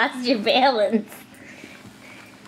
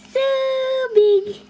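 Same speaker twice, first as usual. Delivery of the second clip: cheering. Real, not acted. Cheering baby's size game.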